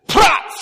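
"prats!" shouted short and punchy; Hebrew pronunciation. Shown as a demonstration. Teaching the congregation the Hebrew word, shouted. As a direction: bark "prats!" sharply. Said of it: "the way you pronounce it is".

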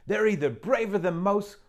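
put-on a motherly beaver teasing the girl; Missus Beaver.

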